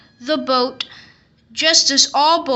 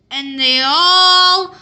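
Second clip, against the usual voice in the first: all stretched, loud and slow; slow, loud storyteller's build; dramatic build; 4 semitones higher, 6 dB louder, slower than usual. A narrator's reading. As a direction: lean on all for suspense.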